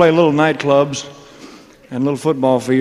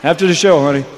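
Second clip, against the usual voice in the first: calling out; 4 semitones higher, 7 dB louder than usual. Real, not acted. Calling out to a fan.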